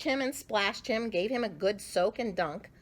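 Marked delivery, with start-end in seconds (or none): none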